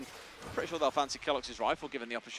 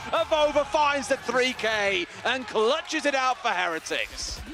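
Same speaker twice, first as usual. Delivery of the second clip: building to a high, loud shout; esports caster; 6 semitones higher, 4 dB louder than usual. Real, not acted. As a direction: call the clutch as it happens. Live play-by-play of a clutch.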